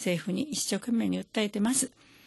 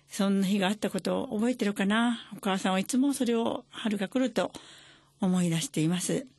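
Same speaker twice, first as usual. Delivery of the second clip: normal talking voice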